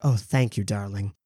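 a gracious lady sipping her tea; Helen Mirren. Acted. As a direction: Helen Mirren thanking Ruby, low and soft. Helen Mirren thanks Ruby for tea.

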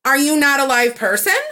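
loud, high sarcastic rant; furious sarcastic outburst at the clerk; indignant rant. Retold or imitated. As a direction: tear into the clerk with mocking disbelief. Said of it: "I left out the F word".

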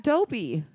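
Dobby said with a long o, DOH-bee. Real, not acted. Naming the card's character, Dobby pronounced DOH-bee. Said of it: "said it the same way as me".

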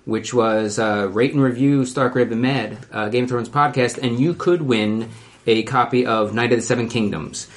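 ordinary talk

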